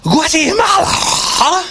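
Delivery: high, slow wail of mock dismay; hammed-up anguished cry; mock horror.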